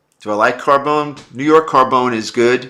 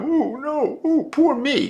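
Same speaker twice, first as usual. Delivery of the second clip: much higher whiny mock falsetto; mocking whiner; slower than usual. Mocking the complainers to his chat in a high whiny falsetto. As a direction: mimic a self-pitying complainer, high and whiny.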